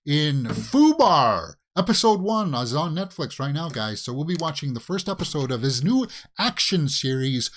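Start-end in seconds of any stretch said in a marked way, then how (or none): 0.0-1.5 drawn out. Real, not acted. Hyping the show title.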